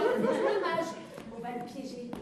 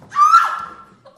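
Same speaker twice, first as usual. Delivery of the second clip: shrill scream; 21 dB louder than usual. Acted. Cri de panique.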